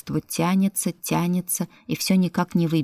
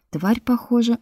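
ordinary delivery

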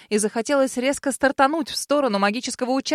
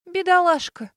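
higher, pitying lament; mock pity for the poor thing; 8 semitones higher, 3 dB louder than usual. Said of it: "пожалела я дракона, причитая".

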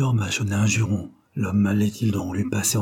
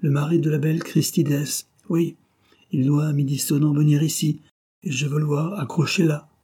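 higher, emphatic voice full of hatred; hateful; 6 semitones higher than usual. A narrator's reading. Dying man wants his enemy hanged, hateful. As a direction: demand vengeance with raised, hateful intensity. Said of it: "prononcés d'une voix où soufflait la haine".

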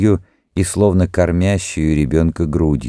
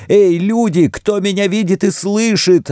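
very high, wild appeal; calling out frantically; 14 semitones higher than usual. A narrator's reading. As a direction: summon everyone as witnesses, in a frenzy. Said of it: "обратилась она к присутствующим". (исступлённый призыв высоким голосом)

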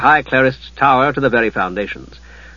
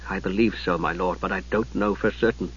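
much softer, low answer; quietly; 6 semitones lower, 10 dB quieter than usual. Acted. Palin quietly answering the lord. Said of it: "then said quietly".

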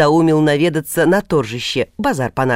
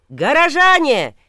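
much higher, slow bellowed announcement; town crier shout; 12 semitones higher, slower than usual. Acted. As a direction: call it across a crowded market. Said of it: "как орут беречи".

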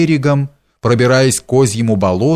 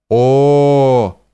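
long sustained "oh" wail; storyteller's voiced howling; drawn-out howl; 3 semitones higher, 3 dB louder than usual. A narrator's reading. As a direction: hold one vowel like a distant howl. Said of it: "раздался протяжный вой".

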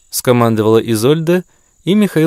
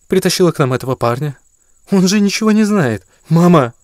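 heated daughter; 7 semitones higher than usual. Acted. Daughter argues with mother.

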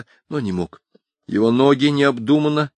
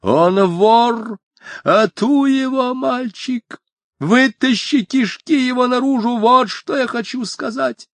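shrill terrifying shout; 13 semitones higher, slower than usual. Acted. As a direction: shriek like a man gone mad. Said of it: "закричал папаша пронзительно страшным голосом".

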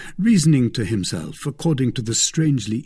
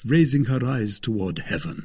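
usual speaking voice

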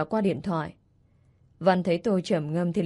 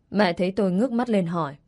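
ordinary delivery, nothing marked

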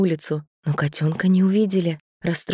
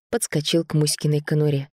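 ordinary delivery, nothing marked

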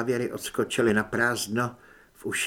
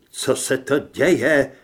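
loud, slow startled question; crying out; 6 dB louder, slower than usual. A narrator's reading. Doctor cries out as his patient attacks. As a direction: call out in alarm. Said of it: "vykřikl Lucius".

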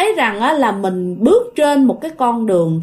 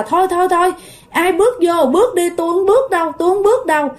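high, hurried mock refusal; retold protest; 7 semitones higher, faster than usual. Mother refuses the queue pushing her toward the hole, mock protest. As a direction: imitate someone backing out in a hurry. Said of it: "mẹ mới lên tiếng".

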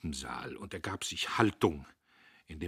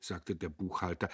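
usual speaking voice

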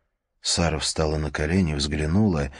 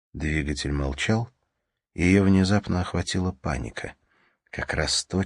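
usual speaking voice

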